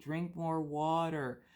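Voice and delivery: slow, nagging husband voice; mock a fussy husband pushing water; imitating husband. Wife mimicking her husband nagging her to drink water, slow mock voice. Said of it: "And he's like".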